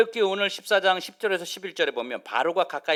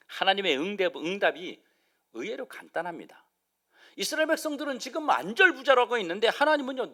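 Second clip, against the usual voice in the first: ordinary delivery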